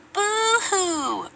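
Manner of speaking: high, sing-song mock crying. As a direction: mimic a crybaby to taunt them. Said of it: "Ernie mocked".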